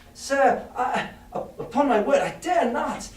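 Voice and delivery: high, scared pleading; frightened boy. Acted. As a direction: plead nervously, high and tense.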